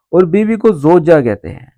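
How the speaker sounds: ordinary talk, nothing marked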